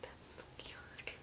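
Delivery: breathy hushed whisper, slowed down; whispered aside. Retold or imitated. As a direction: whisper it to yourself in disbelief. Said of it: "so I'm just kinda like".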